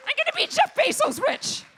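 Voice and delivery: high, loud gloating boast; hammed-up greedy cheer; triumphant shout. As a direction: crow it like a winner.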